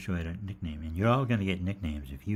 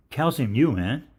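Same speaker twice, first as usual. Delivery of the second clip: hyped-up greeting; 7 semitones higher, 9 dB louder, slower than usual. Retold or imitated. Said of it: "I've been saying".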